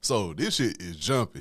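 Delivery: ordinary talk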